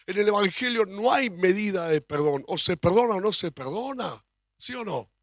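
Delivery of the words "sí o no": ordinary delivery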